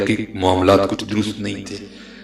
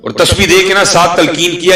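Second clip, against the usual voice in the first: higher and louder, fervent preaching; preaching at full force; 7 semitones higher, 6 dB louder than usual. Acted. Preacher to his congregation, full-force sermon voice. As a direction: lift the congregation with every name.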